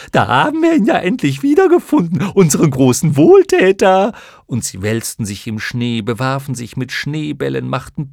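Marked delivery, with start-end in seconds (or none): 0.1-4.1 very high, loud, mocking creature voices; a giddy swarm jeering at its benefactor; clown moth chorus. Schlamuffen-Chor begrüßt den Jungen. (hohe, laute, spöttische Mottenstimmen)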